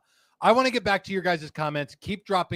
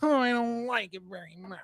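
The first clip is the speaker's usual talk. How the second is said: high, whiny complaining; 5 semitones higher, 4 dB quieter than usual. Acted. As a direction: whine it like a sulky kid. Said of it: "You gotta get your whiny voice on".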